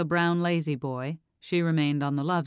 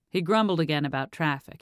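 ordinary delivery

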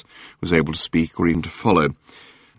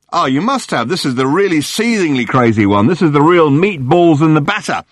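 gushing tabloid hack; 7 semitones higher, 3 dB louder than usual. Acted. Journalist Murray greeting Arthur on the phone, gushing. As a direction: lay the flattery on thick.

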